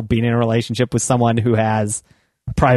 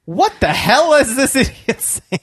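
mock exasperated inner voice; 11 semitones higher than usual. Imitating own inner voice.

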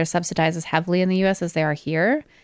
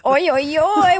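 oi oi, rising and loud; jeering oi oi; mock cheer; 9 semitones higher, 4 dB louder, slower than usual. Real, not acted. Teasing after pun.

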